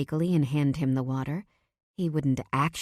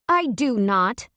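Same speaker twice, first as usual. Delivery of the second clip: higher, louder, slow denial; too defensive; 6 semitones higher, 5 dB louder, slower than usual. A narrator's reading. Wife denying attraction to husband, defensively. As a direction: protest too much. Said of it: "a little too defensively".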